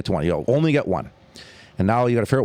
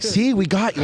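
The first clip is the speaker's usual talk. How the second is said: triumphant retelling; 11 semitones higher than usual. Retold or imitated. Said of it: "I'm like".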